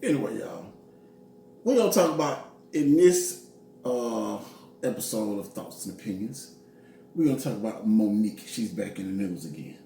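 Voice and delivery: a hint of news reporter, low and soft; mock newscaster read. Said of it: "trying to talk like a reporter".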